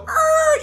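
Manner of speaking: loud, high screeched noise; mock brake screech. Narrator mimicking a hard stop he didn't make, mock screech. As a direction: imitate slammed brakes with a piercing yelp.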